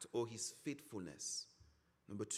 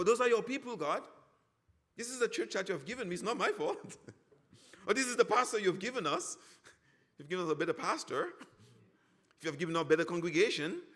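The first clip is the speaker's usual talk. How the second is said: whiny blaming; 3 semitones higher, 5 dB louder, faster than usual. Retold or imitated.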